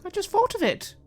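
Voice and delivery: put-on high, evasive child's voice; a kid brushing off the question; little girl voice. Girl answers defensively.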